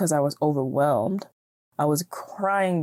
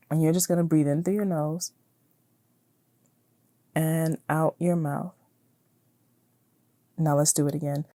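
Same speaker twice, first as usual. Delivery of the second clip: guided breathing. Acted. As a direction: talk them gently through each breath. Leads a breathing exercise.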